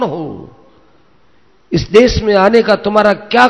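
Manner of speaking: high-pitched, forceful challenge from an ascetic; intimidating challenge. A narrator's reading. Ascetic confronts armed Arjun in his hermitage, intimidating challenge. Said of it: "डरावनी भाषा में बोलकर".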